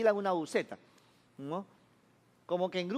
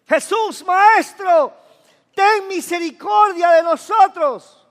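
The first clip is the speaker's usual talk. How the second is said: loud, high-pitched cry for mercy; desperate shout; 7 semitones higher, 12 dB louder, slower than usual. Acted. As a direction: call out at full volume, drawing out the plea. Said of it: "empezaron a gritarle y decían".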